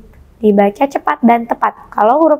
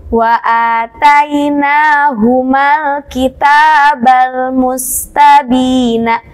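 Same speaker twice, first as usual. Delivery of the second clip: Quran recitation; much slower than usual. Shown as a demonstration. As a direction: recite each syllable slowly and clearly. Teacher recites the example verse. (lantunan bacaan Quran yang lambat dan keras)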